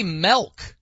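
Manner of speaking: milk said slowly like melk; imitating Ben. Host mimicking Ben's milk on promos. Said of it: "He'll say milk".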